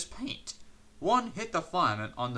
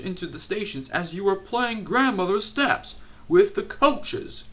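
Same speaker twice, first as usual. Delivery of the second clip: higher, louder angry lecture; scolding; 6 semitones higher, 6 dB louder than usual. A narrator's reading. Controller lectures Percy on the damage, loud scolding. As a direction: tell him off at length.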